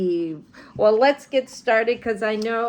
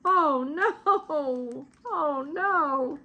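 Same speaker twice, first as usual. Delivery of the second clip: mild crying; 4 semitones higher, 5 dB quieter, much slower than usual. Acted. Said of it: "began to cry".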